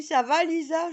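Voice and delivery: high, loud neighbour greeting. Retold or imitated. The neighbour greets her at night, high and loud. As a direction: call out like the friendly neighbour. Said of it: "Il me dit".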